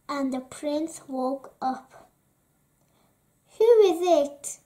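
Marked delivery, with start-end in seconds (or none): none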